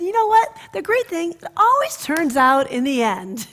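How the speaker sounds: high, loud bright reassurance; reassuring grandmother. Retold or imitated. Grandmother telling the boy it works out, high reassuring voice. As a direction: lift him up like a cheerful grandma.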